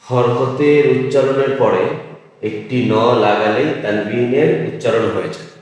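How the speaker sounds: slow, stressing হরকতের; slow restatement of the rule; slow emphatic summary. Shown as a demonstration. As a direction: lay down the rule slowly. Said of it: "খাতায় উঠিয়ে রাখতে পারেন".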